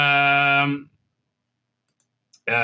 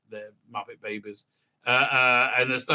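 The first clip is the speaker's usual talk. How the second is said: ordinary talk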